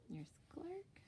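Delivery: whispered aside. Real, not acted. Teacher points to picture.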